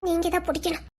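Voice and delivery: very soft undertone; hushed reply. Acted. Asking the other to take the hand, hushed. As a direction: answer under the breath.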